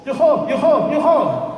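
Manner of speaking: high, loud falling calls; calling out. A narrator's reading. Bored eye raises a false alarm, high loud calling. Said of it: "The eye called out".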